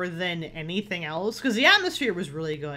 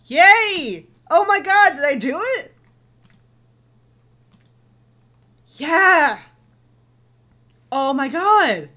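excited cheering; 8 semitones higher, 10 dB louder, slower than usual. Real, not acted. Beating the game, cheering.

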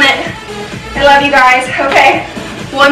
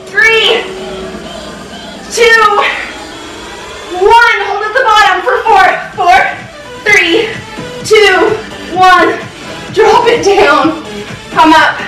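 counting workout reps; 4 semitones higher than usual. Real, not acted. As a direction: push them through the last reps. Counting reps, pushing harder.

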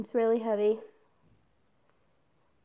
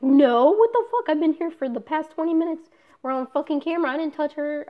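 loud, higher outburst of denial; indignant retold protest; 5 semitones higher, 9 dB louder than usual. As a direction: push back hard at a false accusation. Retold protest against nurses' accusation.

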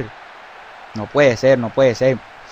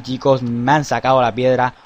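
ordinary talk

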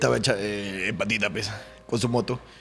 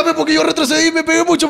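usual speaking voice

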